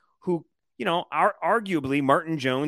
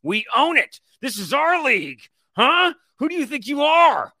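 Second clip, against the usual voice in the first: rising, cocky shouting much louder and higher; power trip yelling; 9 semitones higher, 6 dB louder than usual. Acted. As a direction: bellow it like you own the league. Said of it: "I'm just feeling this power trip right now".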